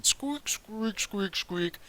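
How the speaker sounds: squeaking that starts to deepen; deepening squeaks. Acted. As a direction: let the rat squeak drop toward a voice. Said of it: "the squeaking starts to deepen".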